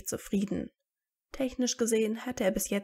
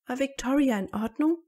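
mildly anxious question, pitch lifted; a touch of worry, higher voice; worried; 4 semitones higher, 4 dB louder than usual. A narrator's reading. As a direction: ask with concern. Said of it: "fragte Hermine besorgt".

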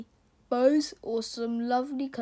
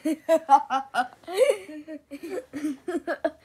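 light laugh; 3 semitones higher than usual. Real, not acted. Reader laughing at the cat's bottom joke. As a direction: chuckle at the funny bit.

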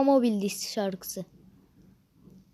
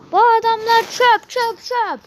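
mocking taunt; 11 semitones higher, 7 dB louder than usual. Mocking the opponents.

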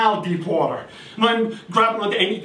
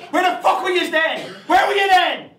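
higher, rising indignant demand; mock rant; 9 semitones higher than usual. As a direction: scold your own gills. Rants at the gills.

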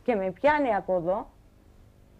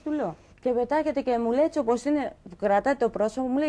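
ordinary talk, nothing marked

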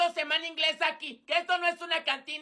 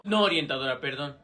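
much lower, mumbled student voice; sullen student; 13 semitones lower than usual. Acted. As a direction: grumble the apology in a deep put-on voice. Alumno regañado contesta a la orientadora. (alumno regañado, voz grave fingida)

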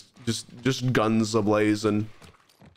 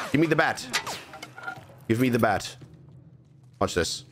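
ordinary talk, nothing marked

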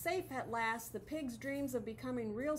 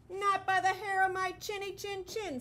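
high, loud frightened squeal; panicked squeal; 8 semitones higher, 7 dB louder than usual. Acted. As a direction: squeak it out in panic like a tiny pig. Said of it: "squealed their little panicked pig".

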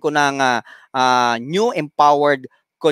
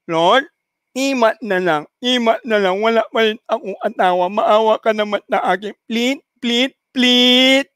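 nasal begging woman; 5 semitones higher than usual. Acted. Woman begging the Lord for a husband, nasal and desperate. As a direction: beg desperately through a blocked nose. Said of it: "Sabi niya".

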